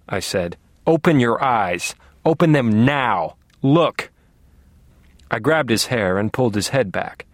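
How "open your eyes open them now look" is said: angry command. A narrator's reading. Captor growing furious.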